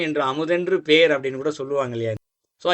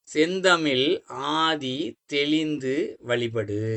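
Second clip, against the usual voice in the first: verse recitation; 2 semitones lower, much slower than usual. Shown as a demonstration. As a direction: draw out each word of the verse. Recites the next verse line. (மெதுவாக இழுத்து செய்யுள் ஒப்பித்தல்)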